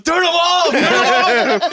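a stoned man begging to make it stop; panicked plea. Retold or imitated. Husband begs wife to switch off the captions, high and panicky.

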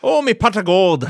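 high, loud Irish leprechaun. Pretend. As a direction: squeal it like a greedy leprechaun. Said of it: "the dancing, like, uh, leprechaun thing".

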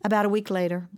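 ordinary talk, nothing marked

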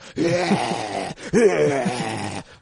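drawn-out undead moan, loud; zombie groan. Retold or imitated. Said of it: "That's a fairly decent zombie impression".

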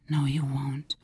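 soft whispered reply; tiny voice. Retold or imitated. The tiny inner voice whispers back to the angry girl. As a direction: whisper it gently but firmly. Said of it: "the tiny voice said".

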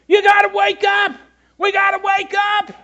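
high, loud sideline shout; screaming coach. Retold or imitated. Football coach trying to rouse his sluggish players, screaming. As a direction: yell it full out like a frustrated coach. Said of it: "their coach kept screaming at him".

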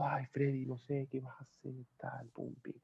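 quiet, almost-crying retelling; casi llorando. Retold or imitated. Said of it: "casi que llorando". (casi llorando, muy bajito, imitando la tristeza)

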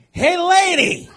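very high, loud yell across the lobby; shouted call. Retold or imitated. Calling out to departing woman.

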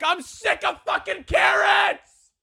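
high, wild, shouting voice of a man who snapped; crazed cannibal yell. Retold or imitated. COO mocked as a screaming man-eater, high crazed shout. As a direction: shriek it like a madman craving flesh. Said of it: "he's like".

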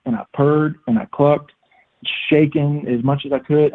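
ordinary talk

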